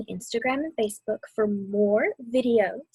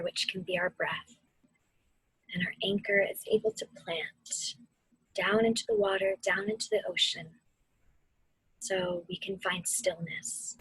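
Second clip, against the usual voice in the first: guided meditation. Acted. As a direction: ease them through the storm and back to calm.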